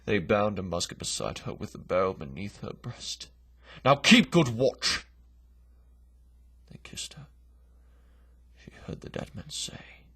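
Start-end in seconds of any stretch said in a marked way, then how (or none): 3.8-5.0 a soldier's jeering bark, slow and loud; mocking shout. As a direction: bellow it as a cruel joke. Soldiers taunting the bound Bess.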